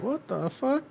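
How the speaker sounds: hammed-up stunned onlookers; mock baffled crowd. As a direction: pitch it up and pull it back, baffled. Everyone reacts to the girl's answer.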